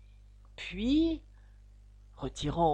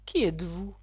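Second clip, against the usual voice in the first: contemptuous. A narrator's reading. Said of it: "d'un ton de mépris".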